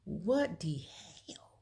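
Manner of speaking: stunned, breathless what the hell; shocked whisper. Retold or imitated. Told the barbecue ran out, a shocked whisper. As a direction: hiss it under your breath in disbelief.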